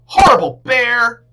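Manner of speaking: high loud furious shout; angry yell. A narrator's reading. Girl stomping through the meadow, yelling angrily.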